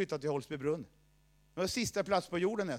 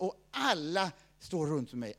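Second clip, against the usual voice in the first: ordinary talk, nothing marked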